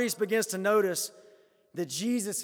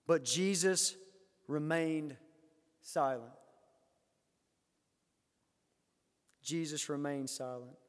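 hushed; 5 semitones lower, 8 dB quieter than usual. Real, not acted. Pause on Jesus' silence.

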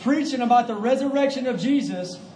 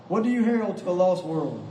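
ordinary delivery

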